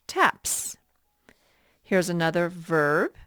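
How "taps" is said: "taps" with a clear hissed s at the end; "taps" as tap plus an s sound. Shown as a demonstration. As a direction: say "taps" high, finishing on a crisp sss. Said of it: "put a S sound at the end".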